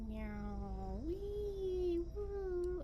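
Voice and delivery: high playful wee-woo sounds; silly noises. Real, not acted. GM making silly noises for the players, playful. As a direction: goof around with sound effects.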